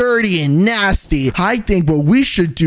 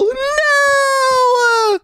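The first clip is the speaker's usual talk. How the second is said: dramatic shout. Acted. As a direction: wail it as if watching disaster. Dragon about to eat axolotl.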